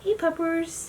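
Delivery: high, cooing greeting; affectionate pet talk. Real, not acted. Owner greeting her little dog, affectionate pet talk. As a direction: coo sweetly up high to a pet.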